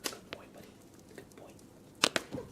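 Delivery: ordinary talk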